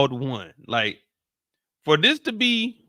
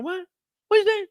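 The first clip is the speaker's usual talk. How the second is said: mock straining to hear. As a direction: act like you can't hear. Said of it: "could barely call him".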